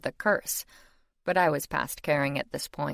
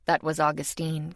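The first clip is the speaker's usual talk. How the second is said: plain reading voice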